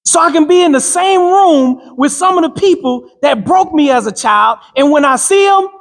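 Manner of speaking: fervent preacher cadence; rousing motivational preaching. Acted. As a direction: drive it home like a sermon to the crowd. Preaching to a crowd.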